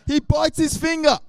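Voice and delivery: wild mock-action telling; excited play-by-play. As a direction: shout the fight like a cartoon. Acting out the fight.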